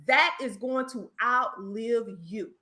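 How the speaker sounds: normal talking voice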